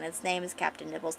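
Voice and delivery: ordinary talk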